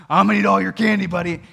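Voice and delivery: big mock-menacing dad threat; playful taunting. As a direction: tease a small child loudly. Teasing his son in retold story.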